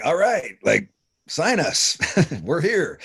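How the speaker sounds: we showed up eager, mock-excited. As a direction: play it like a band ready to sign. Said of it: "and so we're like".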